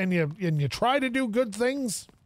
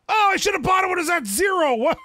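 high, loud and fast, kicking himself; frustrated shout; 10 semitones higher, 6 dB louder, faster than usual. Real, not acted. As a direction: yell it out in real regret at the missed deal. Regret at a missed bargain.

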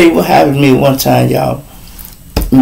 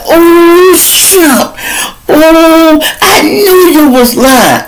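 very high, louder woman's voice, slowing at the end; caught-out girlfriend; 3 dB louder, much slower than usual. Retold or imitated. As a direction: squeal it like she's just caught him out. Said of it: "she like".

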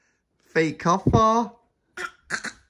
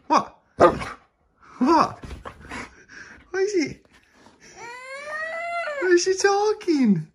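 high pet talk. Real, not acted. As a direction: coo up high at the puppy. High cooing at the dog.

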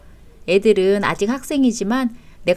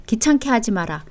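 normal talking voice

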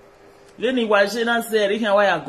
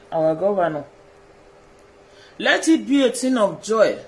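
ordinary delivery

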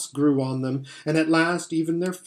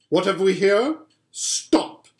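higher-pitched imperious witch voice; a witch calling a sudden halt; imperious, raised witch's voice; commanding witch; 5 semitones higher, 3 dB louder than usual. A narrator's reading. Witch halts the sledge.

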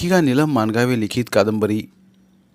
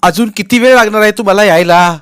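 loud, higher snapping wife; snapping angrily; 10 semitones higher, 11 dB louder than usual. Acted. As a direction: bite his head off on the phone. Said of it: "एकदम खेकसून म्हणाली".

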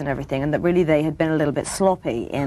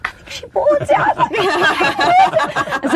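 very high, laughing squeal of disbelief; gleeful squeal; 4 dB louder, much slower than usual. Retold or imitated. Actress after fooling the producer, gleeful high squeal. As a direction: squeal with delight at getting away with it. Thrilled the prank worked.

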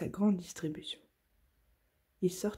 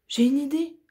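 much higher and louder, a bright sudden idea; excited exclamation; 7 semitones higher, 8 dB louder than usual. Acted. Oui-Oui to Santa with a fix, excited exclamation. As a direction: blurt it out, thrilled. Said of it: "s'exclama Oui-Oui".

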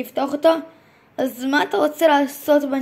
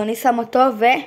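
normal talking voice

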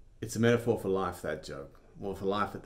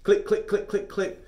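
high, loud clicking of an empty gun; gun clicks; 14 semitones higher, 9 dB louder than usual. Retold or imitated.

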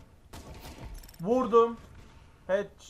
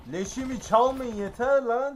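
angry yell; 7 semitones higher, 6 dB louder than usual. Real, not acted. Gamer yells at kill-stealers.

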